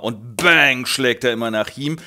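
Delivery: normal talking voice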